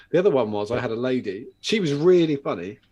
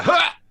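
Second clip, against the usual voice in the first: exclamation; 8 dB louder than usual. Retold or imitated. Heard her throw the parcel.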